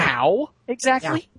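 high, slow, disbelieving question; incredulous. Real, not acted. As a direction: ask it in utter disbelief. Incredulous at plot hole.

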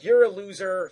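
lightly acted marital jab; retold angry wife. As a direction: imitate a fed-up wife scolding. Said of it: "so now she's doubly mad".

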